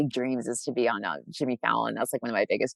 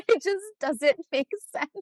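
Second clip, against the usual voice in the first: much higher, squeaky laugh through the words. Real, not acted. Quoting people puzzled by her two sides, laughing. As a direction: talk through real giggles.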